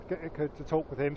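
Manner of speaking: ordinary talk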